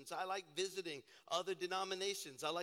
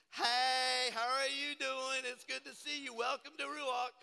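high, louder welcoming greeter; eager greeter; 6 semitones higher, 6 dB louder than usual. Retold or imitated. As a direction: welcome them like a beaming usher.

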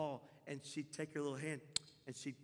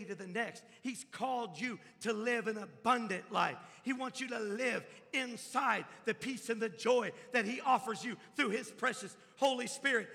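building preaching, getting louder and higher; 5 semitones higher, 8 dB louder than usual. Acted. Preacher urging the church to an abundant life, rising preaching. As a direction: build the sermon up and lift it.

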